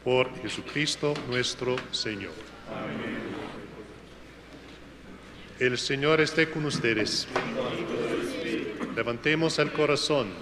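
ordinary talk, nothing marked

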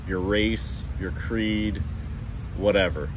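ordinary talk, nothing marked

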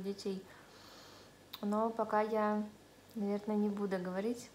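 talking with ginger on lips; food held at lips. Real, not acted. Seen 0: Chopsticks press red pickled ginger against lips while speaking, 1.4–6.1s.

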